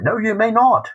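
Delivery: put-on very high, loud English-accent refusal. Bodleian gentleman refusing a researcher the papers, mock English accent. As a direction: imitate an Englishman, voice leaping up high. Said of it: "excuse me for this accent".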